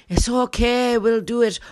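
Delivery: high, loud, hammed-up reassurance; husband's voice. Husband answering worried wife, high insistent voice. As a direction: play the eager husband overruling her worries. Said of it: "And he's like".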